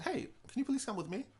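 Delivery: high, soft, sweetly polite imitation; sweet polite girl. Clerk sent to charm the thieves, sweet voice. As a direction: ask it nicely, like bait.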